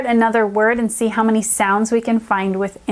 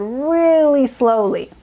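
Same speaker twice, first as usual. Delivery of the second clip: drawn-out; 3 semitones higher, slower than usual. Shown as a demonstration. Teacher explains the coach's repeat, drawn-out. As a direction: stretch out REALLY slowly. Demonstrates slow reading while describing it.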